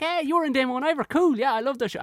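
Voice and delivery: high, chirpy fanboy voice; friendly young guard. Retold or imitated. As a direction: gush like a starstruck young lad. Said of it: "he so didn't sound like".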